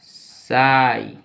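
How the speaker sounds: साई very slow, with a long "s" and long "aai"; pronunciation demo. Shown as a demonstration. Teacher modelling the next word, slow drawn-out साई. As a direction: stretch साई right out. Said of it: "इसको".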